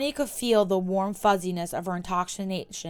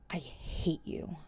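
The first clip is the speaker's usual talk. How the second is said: slow, much softer, worn out; quiet hatred; 2 semitones lower, 10 dB quieter, slower than usual. Acted. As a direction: breathe it out, beaten. Said quietly after long pause.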